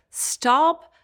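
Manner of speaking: high, loud call of stop, rising; sharp stop. Real, not acted. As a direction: cut her off with a loud stop. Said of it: "stop treating him".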